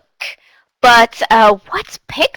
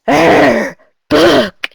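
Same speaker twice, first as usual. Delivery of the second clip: a roaring mock lion, louder; 3 semitones higher, 5 dB louder than usual. Shown as a demonstration. A teacher growling a word as a lion for young learners. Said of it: "in a lion's voice".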